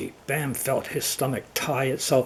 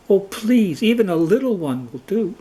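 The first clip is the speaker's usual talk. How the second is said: pleading; 7 semitones higher than usual. Acted. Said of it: "Bam insisted".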